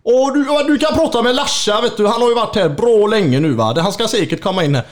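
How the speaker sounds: high, loud Gothenburg dialect; hearty Gothenburg geezer voice; Gothenburg old man. Retold or imitated. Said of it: "riktigt god göteborgsgubbe som bara".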